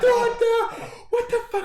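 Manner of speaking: very high, hysterical mock wail; screaming and crying impression. Storyteller imitating his pranked friend, screaming and crying. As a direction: imitate a friend shrieking in tearful panic. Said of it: "He's, like, screaming and crying".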